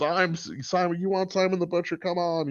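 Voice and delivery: high, quiet, hammed-up mock quote; Simon. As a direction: imitate a character's line in a high, silly voice. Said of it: "makes it silly when he goes".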